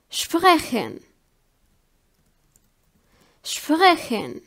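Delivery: "sprechen" opening on shp, SHPREH-khen; German SP as SHP. Shown as a demonstration. Teacher giving an example for learners, "sprechen" with shp. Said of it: "it's pronounced like".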